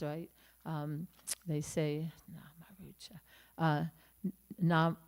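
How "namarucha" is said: a breathless whisper of "Namarucha"; hushed reverent whisper. Acted. Names the holy principle hushed.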